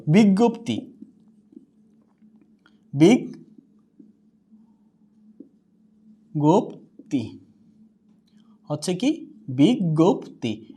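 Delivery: বিজ্ঞপ্তি split into "বিগ ... গোপ্তি", very slow and soft; slow broken-down pronunciation. Shown as a demonstration. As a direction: break বিজ্ঞপ্তি into বিগ and গোপ্তি slowly, then join it up. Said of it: "syllable-by-syllable model".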